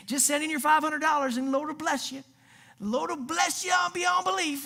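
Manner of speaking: high, hammed-up Southern televangelist pitch; mock a Southern preacher begging for donations. Money-seeking preacher promising blessings, mock Southern accent. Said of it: "I don't know why it's always Southern".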